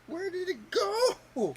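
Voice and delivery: mock wailing. Real, not acted. Piece lost, struggling.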